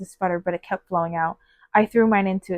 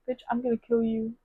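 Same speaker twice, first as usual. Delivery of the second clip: 1 mock annoyed aside; 4 dB quieter than usual. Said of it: "I was like".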